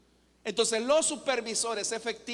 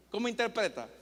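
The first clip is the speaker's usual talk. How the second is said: usual speaking voice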